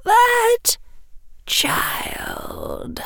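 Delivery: put-on breathy whisper on child. A narrator's reading. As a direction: trail off into a whisper. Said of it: "muttered Bellatrix".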